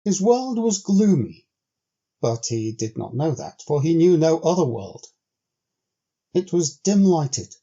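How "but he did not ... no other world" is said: plain reading voice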